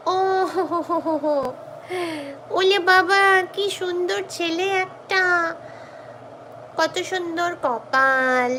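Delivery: fast high cooing to a cat. Real, not acted. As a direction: coo over the cat in Bengali. Bengali baby talk to cat.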